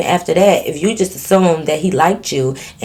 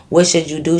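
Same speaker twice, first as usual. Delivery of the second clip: ordinary delivery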